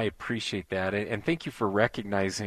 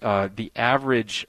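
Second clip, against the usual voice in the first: usual speaking voice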